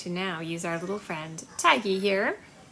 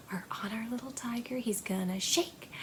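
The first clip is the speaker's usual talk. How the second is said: playful whisper; 10 dB quieter, faster than usual. Pretend. Storytime game, hushed.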